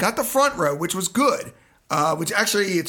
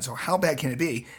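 ordinary talk, nothing marked

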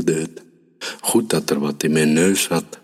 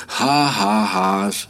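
higher-pitched mock laugh in words; jeering call; 4 semitones higher, much slower than usual. A narrator's reading. Hunter jeers at the fleeing hare, calling out. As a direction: taunt with a spoken ha-ha. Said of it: "riep ik".

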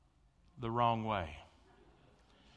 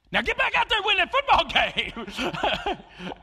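much higher, louder and fast, breaking into a laugh; rousing shout; 9 dB louder, faster than usual. Retold or imitated. Pastor as the coach sending Riegels back in, laughing shout. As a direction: fire him back onto the field.

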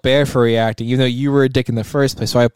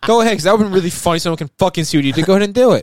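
loud high goading; egging him on, shouting; taunting yell; 9 semitones higher, 5 dB louder than usual. Acted. Taunting friend to do bit.

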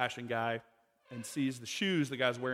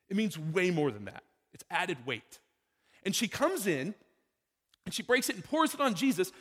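ordinary delivery

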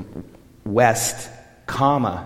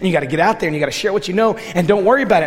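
louder and higher as the push builds; retold rising pep talk; 6 semitones higher, 6 dB louder, much faster than usual. Pressing Trini to share, a loud pep talk. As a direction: drive it home like a coach. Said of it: "And I said".

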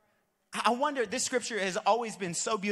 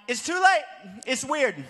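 exasperated outburst; 3 semitones higher, 5 dB louder than usual. Retold or imitated. Pastor to his friend, embarrassed louder outburst. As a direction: blurt it out, embarrassed. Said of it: "And I'm like".

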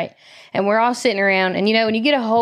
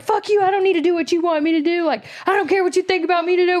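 half-hearted screaming back, pitched way up; retold screaming match; 9 semitones higher than usual. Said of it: "We're just screaming at each other".

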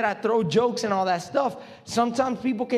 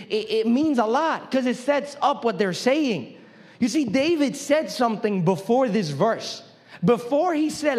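normal talking voice

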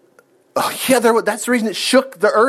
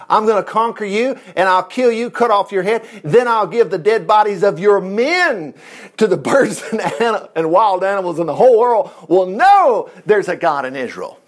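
raised, declaimed battle threat, stretching key words; David shouting back; 3 semitones higher, 3 dB louder than usual. Acted. David vowing to Goliath before the whole world, building defiant shout. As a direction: build it to a ringing proclamation. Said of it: "David shouted back".

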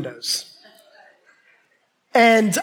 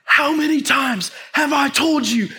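high, raised-voice scolding; mad dad; 5 semitones higher than usual. Retold or imitated. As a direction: scold like an angry father. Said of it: "and he is mad".

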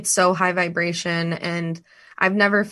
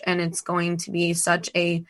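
normal talking voice

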